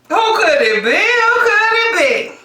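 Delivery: high teasing who could it be; teasing sing-song. Acted. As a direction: tease the reveal, rising high. Teasing build-up, high second take.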